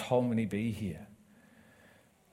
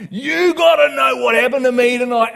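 much higher and louder, bursting with news; excited outburst; 12 semitones higher, 12 dB louder than usual. Retold or imitated. Waking the flatmates to tell them what happened, excited shout.

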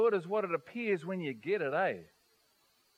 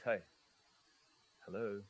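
hushed, tender greeting, much softer; soft gentle hello; 12 semitones lower, 14 dB quieter, faster than usual. Pretend. Preacher voicing God's gentle greeting, hushed and soft. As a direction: greet them softly like a loving God.

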